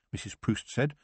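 usual speaking voice

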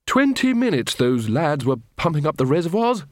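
Ridcully voice. Acted.

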